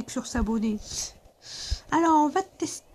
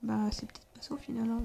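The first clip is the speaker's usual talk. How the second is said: whispering; 9 dB quieter than usual. Real, not acted. Quiet aside in horror game.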